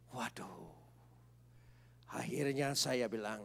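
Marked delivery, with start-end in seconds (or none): 0.1-0.7 whispered gasp. Acted. Fearful reaction to God.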